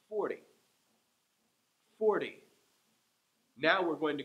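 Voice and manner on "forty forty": forty with the first syllable louder; FOR-ty, first syllable strong, then fall; stress on FOR. Shown as a demonstration. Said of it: "Let's try it again".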